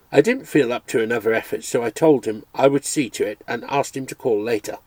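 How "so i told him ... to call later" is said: usual speaking voice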